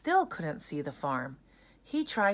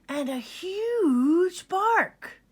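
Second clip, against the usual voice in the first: dramatic build; 13 semitones higher, 6 dB louder, much slower than usual. A narrator's reading. Narrator dramatizes the huge bark.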